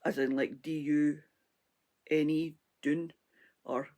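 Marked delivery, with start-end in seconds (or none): 2.8-3.1 drawn-out "doon", the vowel of moon; Scottish doon. Shown as a demonstration. Spelling out the Scottish word for viewers, slow "doon". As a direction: spell it out, then "doon" with a long oo. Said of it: "We say doon".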